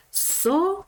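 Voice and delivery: teacher models "Сова" slowly, high; sounding out a word. Shown as a demonstration. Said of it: "звукова модель цього слова". (вимова слова "Сова" для дітей)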